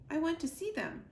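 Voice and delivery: put-on high young voice pressing to see; pleading gosling. Gosling insists to Roz that he see the robots, high and pleading. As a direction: beg like a stubborn young bird.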